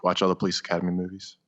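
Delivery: usual speaking voice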